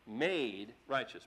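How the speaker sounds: "Made righteous" slow, low, with "Made" leaned on; stress on made. Shown as a demonstration. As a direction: drag out "Made" and let it fall. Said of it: "Brother Des pointed that out".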